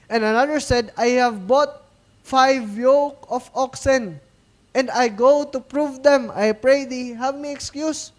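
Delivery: loud, slow preaching read; booming Bible reading from the pulpit; scripture reading. Acted. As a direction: proclaim the verse slowly and loudly. Reads scripture aloud.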